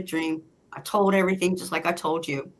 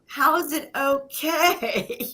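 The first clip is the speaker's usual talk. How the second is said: high, drawn-out disbelief; incredulous; 7 semitones higher, slower than usual. Retold or imitated. Wife questioning husband after he lost his job, incredulous. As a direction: stretch the question out, pitched up in protest.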